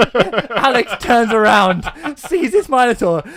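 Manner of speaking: high, loud, through real laughter; laughing excitement. Real, not acted. Teller reaches the minotaur reveal, laughing excitement. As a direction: crack up while reaching the climax. Laughing while telling the climax.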